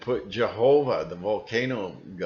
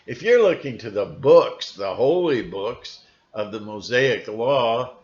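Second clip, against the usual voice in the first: ordinary talk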